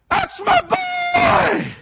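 very high, stretched-out scream; proud father yelling. Retold or imitated. Daddy cheering his son from the stands, proud scream. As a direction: holler it slowly from the stands, bursting with pride.

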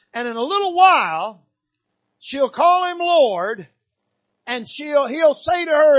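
high, loud preaching build; rising preaching. Acted. Preacher building the Syrophoenician woman's story to the church. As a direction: lift it toward the climax.

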